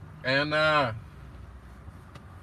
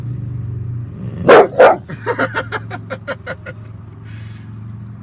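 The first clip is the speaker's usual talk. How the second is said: loud, very high burst of laughter; long, high belly laugh tailing off; 13 dB louder than usual. Real, not acted. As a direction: crack up hard at your dog. Laughs at banged-up dog.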